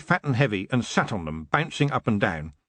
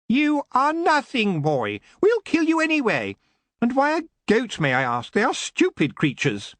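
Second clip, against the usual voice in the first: sneering elf; 12 semitones higher than usual. Acted. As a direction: look down on the boy from a cold height.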